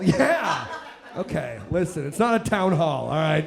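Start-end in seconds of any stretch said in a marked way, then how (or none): none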